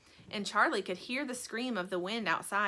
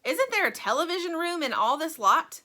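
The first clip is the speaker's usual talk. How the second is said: put-on bratty boy; 7 semitones higher, 6 dB louder than usual. A narrator's reading. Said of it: "asked Mike T V".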